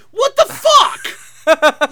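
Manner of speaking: loud, slow, laughing outburst of disbelief; exasperated shout. Real, not acted. Ranting to Jake about male bonding, laughing exasperated shout. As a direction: throw it out in laughing outrage.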